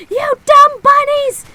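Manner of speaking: put-on high, full-force child's scream; screaming tantrum. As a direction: scream it at the top of your lungs, drawn out. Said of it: "screaming at the top of my lungs".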